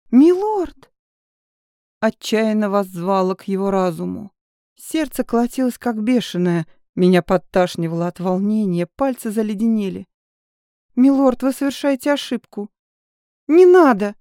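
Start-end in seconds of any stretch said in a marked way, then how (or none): 0.1-0.9 high, shrill-pitched desperate cry; desperate high shout. Acted. Heroine calls again, louder. 10.9-14.1 high, loud frightened shriek. Acted. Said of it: "Мой визг".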